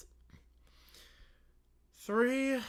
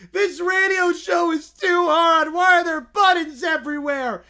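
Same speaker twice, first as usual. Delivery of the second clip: high loud slow whine; a child overwhelmed and yelling; frustrated kid shout; 7 semitones higher, 10 dB louder than usual. Acted. Caillou fed up with the studio buttons.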